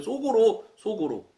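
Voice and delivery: ordinary talk, nothing marked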